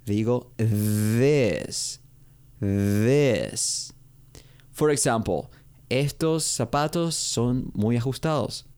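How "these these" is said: "this" drawn out as THEESE, long ee; long double-i vowel. Shown as a demonstration. Teacher showing the plural to learners, long-drawn THEESE. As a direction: stretch the vowel of "this" into a long ee, like "these". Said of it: "lo digo como con doble I en español".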